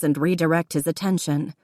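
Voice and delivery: ordinary delivery, nothing marked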